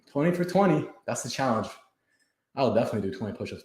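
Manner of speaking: usual speaking voice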